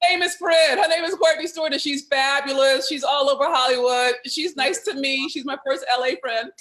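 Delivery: loud, high, gleeful hype; excited introduction. Real, not acted. Host presenting her best friend to viewers, excited. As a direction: cheer her on like a proud friend.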